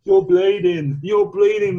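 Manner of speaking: high, loud mock shouting; imitated onlookers' alarm. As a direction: yell it like a panicking crowd. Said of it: "they're like this".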